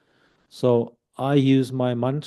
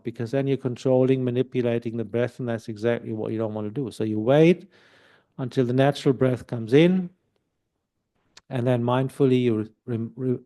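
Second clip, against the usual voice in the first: usual speaking voice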